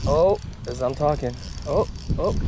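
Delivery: ordinary talk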